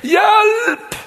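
high, loud comic yelp of "Hjelp!"; laughing shout for help; mock cry for help. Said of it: "favorittbønnen min".

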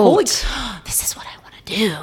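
breathless, hushed wonder; awed whisper. Retold or imitated. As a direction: whisper it in sheer awe. Childhood awe at discovering theater.

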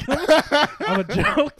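loud, high, laughing through it. Real, not acted. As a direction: drag the words out through laughter. Laughing at himself being mocked.

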